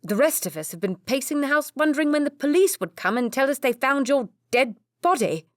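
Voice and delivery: high, loud furious scolding; angry rant. A narrator's reading. Brother ranting at Philip about the police, furious. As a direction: let the fear burst out as anger. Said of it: "Edward continued to rant".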